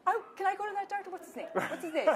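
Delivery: high, quick, laughing mock plea; eager joking customers. Bar guests ask for the wine-prescribing doctor, high mock eagerness. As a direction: ham up the envious customers with a laugh.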